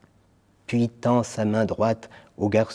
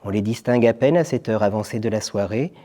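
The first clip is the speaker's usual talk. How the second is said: usual speaking voice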